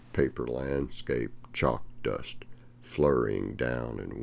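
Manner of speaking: reading a poem aloud to the class, unhurried; teacher reads the Billy Collins poem to students, measured and expressive. Acted. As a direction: let each image land as you read the verse.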